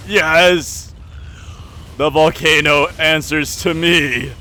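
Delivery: loud, slow, high villain proclamation; slow theatrical villain menace. Acted. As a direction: proclaim it like a mad tyrant. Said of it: "see me turn this evil".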